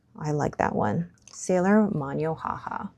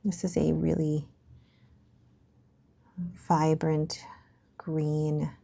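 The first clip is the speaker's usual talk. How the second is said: hushed whisper; 6 dB quieter than usual. Real, not acted. Vlogger whispering over the green ink swatch. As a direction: whisper the colour like a secret.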